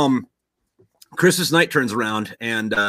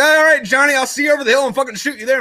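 high, fast, chummy threat; quick pitched-up send-off; mock threats; 12 semitones higher, 5 dB louder than usual. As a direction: act out a jolly enemy farewell. Soldiers part with mock threats.

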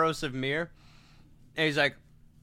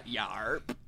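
low, soft one-word grunt; film character catchphrase; 7 semitones lower, 12 dB quieter than usual. Acted. Quoting a comedy film's simple big man, low soft deadpan. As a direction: drop low and quiet for the catchphrase. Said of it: "in fucking Hot Fuzz".

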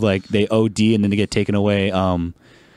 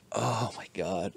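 weary sigh; 13 dB quieter, slower than usual. Real, not acted. Weary aside about routine deaths.

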